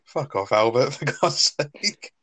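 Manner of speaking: slow, lightly put-on exasperation; exasperated Del. Del telling Albert off, slow mock exasperation. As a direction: drag it out in mock despair. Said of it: "It's just like".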